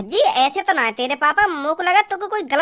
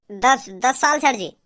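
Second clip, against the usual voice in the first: slow sheepish answer; 4 semitones lower, slower than usual. Acted. Student answering teacher.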